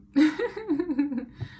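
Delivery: sudden high bright laugh; amused laugh at the birds; burst of laughter. Real, not acted. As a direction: laugh out loud at the noisy birds.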